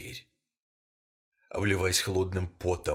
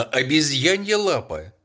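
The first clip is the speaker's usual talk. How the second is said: higher and louder, a wild scream; frantic cry; 6 semitones higher, 7 dB louder than usual. A narrator's reading. Wife screams for the paw in the night. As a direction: shriek it out like a woman gone mad. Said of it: "кричала она безумно".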